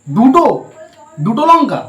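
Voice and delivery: high, loud shocked question; outraged at two chillies; shocked disbelief. Real, not acted. (অবাক হয়ে চেঁচিয়ে প্রশ্ন)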